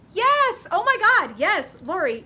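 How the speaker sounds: high, loud burst of delight at a viewer's message; thrilled squeal. Real, not acted. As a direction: squeal the yes with real joy.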